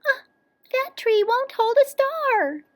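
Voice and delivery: very high little cub voice; little bear crying out. A narrator's reading. Little bear sees the tree's flaw, crying out high. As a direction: cry out in a tiny high bear voice. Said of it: "cried out".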